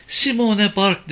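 high, drawn-out nervous answer; uneasy. A narrator's reading. Frightened woman gives her name to police, uneasy. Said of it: "sesi hala tedirgindi".

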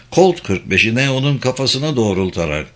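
usual speaking voice

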